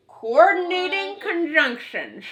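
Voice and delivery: put-on gummy old man saying it. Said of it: "he wants to sound like an old man".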